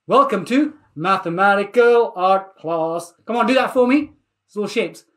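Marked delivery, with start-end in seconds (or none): 0.1-3.1 slow, measured class welcome; ceremonial welcome. Acted. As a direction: space out each word like a grand title. Presents the class name with ceremony.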